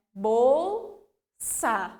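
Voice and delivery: "Bolsa" with both L and S sounded; careful L and S. Shown as a demonstration. Teacher modelling the word for the class. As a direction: sound the L, then the S. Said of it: "eu pronuncio tanto L quanto S".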